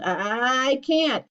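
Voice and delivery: chugging, stuttering "I, I, I can't"; motor-like voice. A narrator's reading. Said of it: "His voice sounded like a motor".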